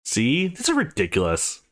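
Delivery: ordinary delivery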